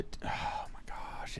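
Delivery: slow breathless whisper of delight; awed whisper. Real, not acted. Recalling school lunches with friends, in a slow whisper. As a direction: let it slip out in a hushed whisper.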